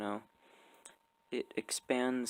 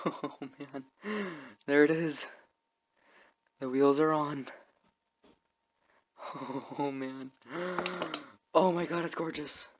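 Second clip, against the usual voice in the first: real giddy excitement, bursting louder and higher; excited freaking out; 4 semitones higher, 6 dB louder than usual. Real, not acted. Builder seeing the wheels finally on, excited freak-out. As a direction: freak out with joy, laughing and gasping between exclamations. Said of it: "I'm sorry for freaking out on you".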